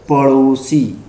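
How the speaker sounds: पड़ोसी with a light tongue flick on ṛ; correct flap. Shown as a demonstration. Teacher answering her own question, पड़ोसी with flapped ṛ. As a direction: say पड़ोसी as paṛosi. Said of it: "क्या पढ़ेंगे".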